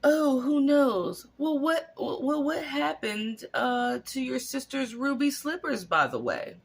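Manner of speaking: Glinda imitation. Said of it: "Glinda does is go".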